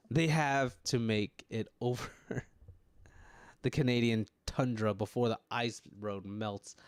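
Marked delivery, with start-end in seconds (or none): none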